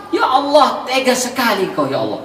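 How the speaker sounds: high, wailing mock complaint; complaining to God. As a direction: act out a whiny protest at God. Acted complaint of angry believer. (keluhan merengek dengan suara tinggi)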